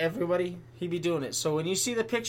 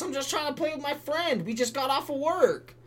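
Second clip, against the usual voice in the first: whiny mock gamer; 8 semitones higher, 4 dB louder than usual.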